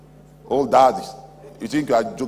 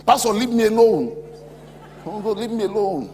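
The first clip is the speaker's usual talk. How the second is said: high, wailed protest; mock-distraught high cry; retold grieving woman; 10 semitones higher, 5 dB louder than usual. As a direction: imitate a distraught woman pushing comfort away. Acts out crying, grieving woman.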